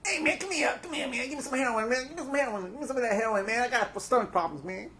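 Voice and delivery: high, mock junkie [name]. Begging for heroin in a high mock [name] voice. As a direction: whine it in a goofy high voice. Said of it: "That's how Kurt Corson talks".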